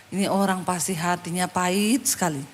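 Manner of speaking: low, soft muttered thought; inner thought. Retold or imitated. Pastor privately sizing up a bitter woman, muttered inner thought. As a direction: think aloud under your breath, low. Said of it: "Dalam hati saya".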